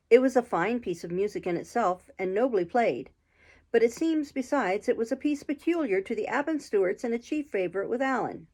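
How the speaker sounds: ordinary delivery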